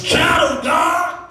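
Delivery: high, ringing pulpit shout; calling out. Acted. Preacher voicing Jesus to the desperate, calling out high. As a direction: call it out high to the flock.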